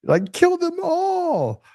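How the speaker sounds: high, slow, strained mock voice of a furious scroller; enraged doomscroller. Mocking the enraged doomscroller in a high, slow squeal. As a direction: squeal it out like someone seething at a post. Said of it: "You're like".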